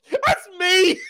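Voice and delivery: high, loud words laughed out in delight; cracking up as he says it; real laughter through the words; laughing. Real, not acted. Laughing at the recognition.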